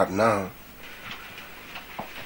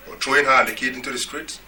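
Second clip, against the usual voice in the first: usual speaking voice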